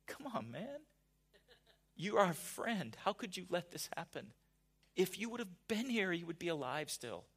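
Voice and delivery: mild mock plea of a hurt friend; exasperated Martha. Preacher voicing Martha reproaching Jesus, mildly exasperated. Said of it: "part of me wonders if she said it like".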